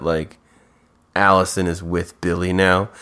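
normal talking voice

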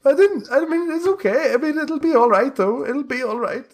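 very high crying voice; tearful boy. Acted. Boy on his knees before questioner, crying. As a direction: whimper excuses in a high pitch. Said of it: "starts crying".

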